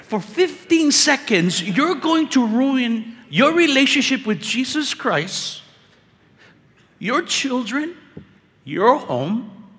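high, drawn-out incredulous exhortation; impassioned preaching. Real, not acted. Preacher builds an incredulous climax.

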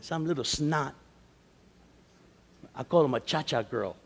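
ordinary delivery

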